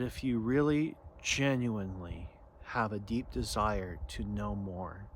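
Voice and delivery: soft, low meditative voice with long pauses; contemplative guidance. Real, not acted. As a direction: gently guide them inward, low and hushed.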